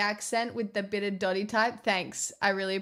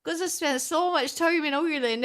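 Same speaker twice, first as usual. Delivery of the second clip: higher, hammed-up Irish take on Ireland; exaggerated Irish accent; 5 semitones higher than usual. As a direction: lay the mock Irish on thick, pitch lifted. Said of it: "I actually just have a really natural Irish accent".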